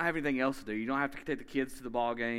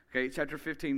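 usual speaking voice

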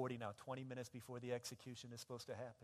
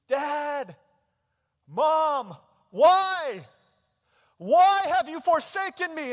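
high full-force scream to a parent; desperate shout; 28 dB louder, much slower than usual. Acted. Son calling out to his parent from the electric chair, desperate screaming. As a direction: cry out in long, anguished high shouts. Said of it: "he began shouting out to you".

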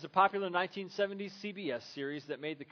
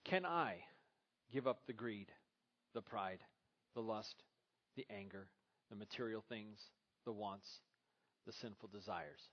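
much softer, lower searching question; quiet self-question; 6 semitones lower, 8 dB quieter than usual. Retold or imitated. Preacher confessing his own struggles to the congregation, soft and low. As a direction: ask yourself quietly, almost confessing. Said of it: "I asked myself the question".

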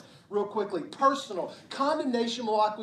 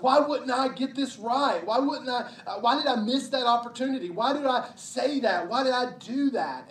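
louder and higher exasperated why questions; frustrated self-blame; 5 semitones higher, 6 dB louder than usual. Retold or imitated. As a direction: berate yourself over the last thing you did.

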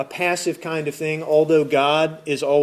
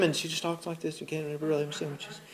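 barely audible low mumble; low talker; 14 dB quieter, faster than usual. Retold or imitated. Pastor imitating the Seinfeld low talker, mumbling. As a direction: mumble it so no one can make it out. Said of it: "this low talking woman".

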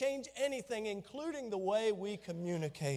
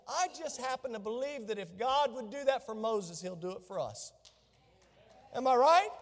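ordinary talk, nothing marked

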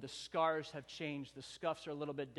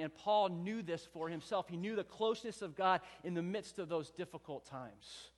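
raised, fervent sermon delivery; preaching; 3 semitones higher, 4 dB louder than usual. Acted. Pastor preaching to the church about Paul, fervent. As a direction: preach it out to the congregation, building. Preacher builds on Paul's suffering.

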